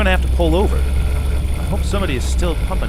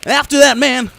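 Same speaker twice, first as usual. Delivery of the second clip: urgent shout; 8 semitones higher, 4 dB louder than usual. Acted. Son urging pursuit.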